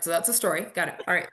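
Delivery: ordinary talk, nothing marked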